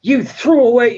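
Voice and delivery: high, indignant sitcom whine; high accusing sitcom voice; Ross quoting. Retold or imitated. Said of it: "he, he goes".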